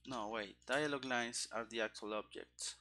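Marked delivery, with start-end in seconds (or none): none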